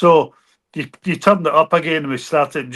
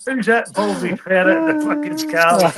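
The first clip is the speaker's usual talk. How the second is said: higher, slower mock rant; a higher, slowed-down put-on rant; imitating a ranting local; 5 semitones higher than usual. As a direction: ham up the outraged local voice. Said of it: "And then he went".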